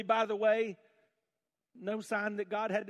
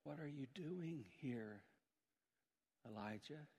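hushed voice of God; 4 semitones lower, 18 dB quieter, slower than usual. Acted. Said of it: "and a voice said".

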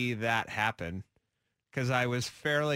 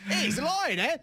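higher-pitched put-on South African accent; mock South African accent; 10 semitones higher, 3 dB louder than usual. Co-host mimicking the other's daughter, mock South African accent. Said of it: "She has a South African accent".

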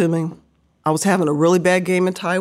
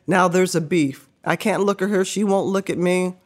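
ordinary talk, nothing marked

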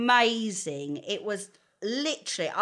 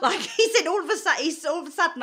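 laughing; 7 semitones higher, 5 dB louder, faster than usual. Real, not acted.

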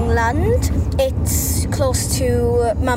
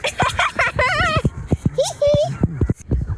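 laughing; 13 semitones higher than usual. Real, not acted. Dad says it's freezing.

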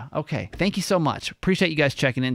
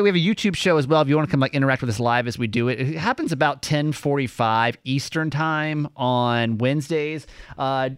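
ordinary talk, nothing marked

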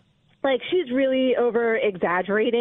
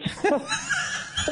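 sharp high scoffing laugh; 4 dB quieter than usual. Real, not acted. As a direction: burst out laughing in scorn. Reacts to accusation.

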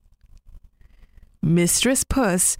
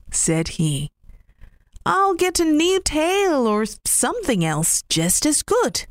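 cheerful rabbit voice; 8 semitones higher than usual. Acted. Rabbit consoling himself after losing his tail, lightly cheerful. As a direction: shrug off the loss brightly.

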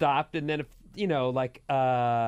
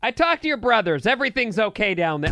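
high, loud cheerful news; chirpy mom blurting it out; 7 semitones higher, 6 dB louder, faster than usual. Retold or imitated. Mom voiced in story.

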